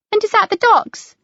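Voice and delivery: put-on a small boy asking eagerly; schoolboy. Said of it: "one of a small party of school children".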